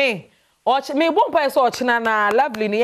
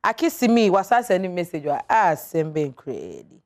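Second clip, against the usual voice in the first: usual speaking voice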